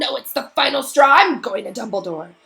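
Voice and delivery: high, loud hysterical caretaker; hysterical rant. Acted. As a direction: rant like you're at the end of your rope. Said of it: "sounded quite hysterical".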